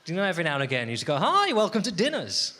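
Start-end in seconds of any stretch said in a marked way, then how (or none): 1.2-2.6 loud, slow showy welcome; TV presenter. Acted. Comic hosting a pretend cookery show, showy presenter greeting. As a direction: greet viewers like a cheesy cookery host.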